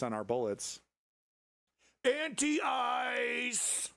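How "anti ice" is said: mock shout. Mock battle cry.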